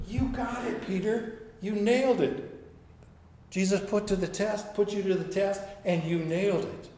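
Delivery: lightly voiced excited disciple; cheering. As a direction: celebrate him like a proud friend.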